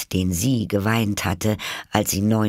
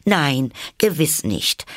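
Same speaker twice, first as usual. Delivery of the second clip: slow, high reassurance; eager to appease; 8 semitones higher, slower than usual. A narrator's reading. Alice hurries to reassure the mouse, eager to appease. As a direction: stretch out an anxious-to-please denial. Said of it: "sagte Alice, eifrig bemüht".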